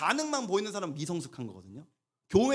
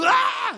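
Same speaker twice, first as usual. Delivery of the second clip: loud, high imitation of a toddler bursting into tears; child's wail; 10 dB louder, much slower than usual. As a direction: wail like a kid who just fell hard. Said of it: "십만큼 울죠". (넘어진 아이처럼 으아 하고 크게 우는 흉내)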